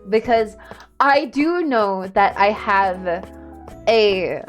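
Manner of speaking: normal talking voice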